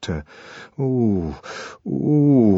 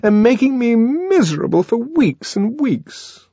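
higher, complaining reproach; exasperated; 11 semitones higher than usual. A narrator's reading. Lenina berating John for his drivelling, exasperated. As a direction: pour out the grievance. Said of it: "so intense was her exasperation".

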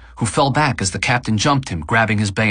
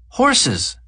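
put-on high excited child cry; child exclaiming. A narrator's reading. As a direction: squeal it like a thrilled kid. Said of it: "the child exclaims".

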